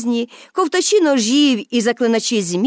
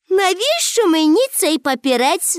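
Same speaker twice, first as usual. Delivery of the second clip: higher, dismissive toss-off; scornful; 7 semitones higher than usual. A narrator's reading. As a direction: brush off the money with scorn. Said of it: "зневажливо мовила Пепі". (зневажливо, трохи вище)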